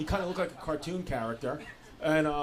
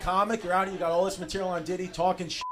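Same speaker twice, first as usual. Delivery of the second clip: ordinary talk, nothing marked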